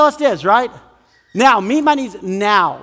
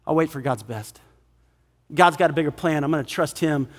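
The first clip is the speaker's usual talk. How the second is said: soft, low, patient voice; hushed, patient resolve; voice of love; 6 semitones lower, 5 dB quieter than usual. Acted. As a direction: answer quietly, low and trusting. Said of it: "And love says".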